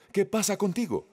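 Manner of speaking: high, reproachful Jesus scolding. Retold or imitated. Preacher voicing a scolding Jesus to Pedro, reproachful. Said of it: "Jesús estaba regañando a Pedro".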